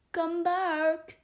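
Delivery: the caterpillar calls after departing Alice; calling after her. A narrator's reading. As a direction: call out to someone walking away. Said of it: "the caterpillar called after her".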